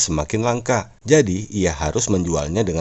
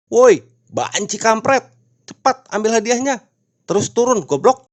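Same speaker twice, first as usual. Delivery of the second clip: yelling crowd; 14 semitones higher, 5 dB louder than usual. A narrator's reading. Annoyed spectators shout at the pole climber, yelling. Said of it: "pada berteriak".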